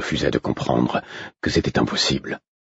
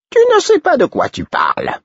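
much higher, louder jester's retort; the Fool's voice; 5 dB louder than usual. Acted. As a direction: shoot it back up high as the Fool. The Fool rebukes.

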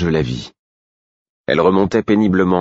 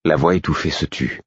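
plain reading voice

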